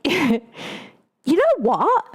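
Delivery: high, drawn-out mock Australian accent; playful, slow Australian twang. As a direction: stretch it out in an exaggerated Australian accent. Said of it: "I'm not gonna do his accent".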